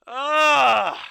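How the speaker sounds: loud, high laugh breaking into an ugh; bitter laugh at his own bad luck; real exasperated laugh-groan; pained laughing groan. Real, not acted. Song mocks his losing game.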